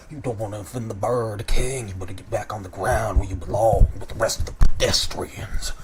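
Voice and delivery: deep chest voice of a taunting crow gang leader; deep-voiced Kenku boss. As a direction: sneer at the newcomer from deep in the chest, like a tough bird boss. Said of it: "he looks back down and says".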